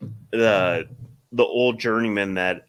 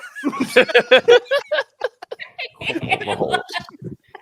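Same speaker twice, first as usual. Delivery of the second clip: high, shrieking, long laugh; long laughing fit; 9 dB louder than usual. Real, not acted. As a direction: lose it laughing. Laughing at the story.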